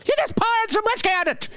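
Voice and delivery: high, hammed-up stage Irishman; mock Irish drinker. Mocking Irish stereotype voice.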